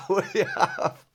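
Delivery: high, loud burst of laughing; delighted laughter. Real, not acted. Pulls a rare card, bursts into loud laughter. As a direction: laugh out in real delight at the pull.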